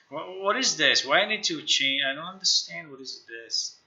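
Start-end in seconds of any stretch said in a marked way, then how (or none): none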